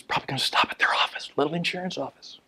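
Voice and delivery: quick whispered aside; hushed aside. Real, not acted. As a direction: drop to a fast whisper. Conspiratorial aside about the President.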